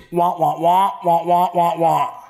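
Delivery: Charlie Brown teacher voice; muted trombone wah wah noises; muffled wah wah talk. Retold or imitated. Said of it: "you hear this".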